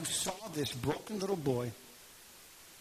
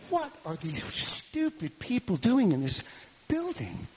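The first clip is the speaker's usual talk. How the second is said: higher, louder scoffing quote; scornful disbelief; 6 semitones higher, 4 dB louder than usual. Retold or imitated. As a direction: scoff in raised-pitch disbelief. Onlooker scoffing at the people inside.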